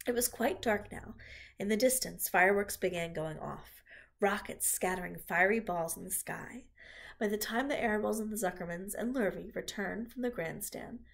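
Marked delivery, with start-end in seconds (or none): none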